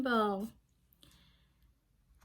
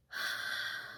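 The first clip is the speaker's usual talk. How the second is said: big sigh; 8 dB quieter than usual. Acted.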